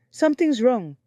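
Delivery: high, loud cry of alarm. Acted. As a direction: cry out that something is wrong. Pregnant wife in sudden pain.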